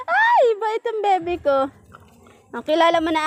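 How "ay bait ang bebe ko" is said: high cooing praise. Real, not acted. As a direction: gush over the pet in a high voice. Praising the pet.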